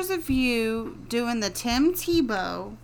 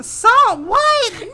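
high, loud baffled outburst; incredulous shout; 13 semitones higher, 12 dB louder than usual. Real, not acted. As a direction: shout it in disbelief. Baffled by the salt line.

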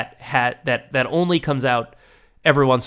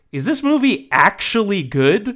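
high, loud amazed asides; mock astonishment; 8 semitones higher, 4 dB louder than usual. Viewer gushing over the villain scene, high astonished voice. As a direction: act stunned the movie is suddenly good. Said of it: "it's like".

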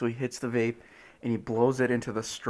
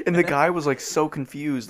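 ordinary talk, nothing marked

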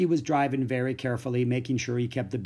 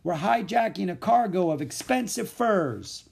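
higher, insistent call; raised, carrying boast; calling back; 7 semitones higher than usual. A narrator's reading. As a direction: call it over your shoulder. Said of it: "Louis called back over his shoulder".

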